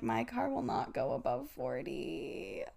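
mock doom, low and slow; defeated self-quote. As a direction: mutter it low and hopeless. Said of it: "and I'm like".

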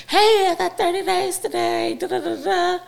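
high, bright sing-song read of her own text; chirpy mock text voice. Mom reading her thirty-day text to the family, high chirpy. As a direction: play up the cheery announcement.

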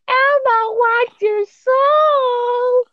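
very high, drawn-out demon toy voice; possessed demonic voice. Retold or imitated. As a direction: stretch it out like a haunted toy. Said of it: "It's in a demonic voice".

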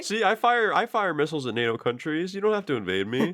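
high-pitched put-on voice; silly high voice, pretend reasoning; mock pleading. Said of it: "Russia's like".

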